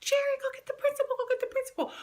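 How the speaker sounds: very high, fast, loud urgent call; frantic best friend. Retold or imitated. Best friend sending for the principal, high frantic. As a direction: shout for help in a squeaky panic. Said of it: "she's like".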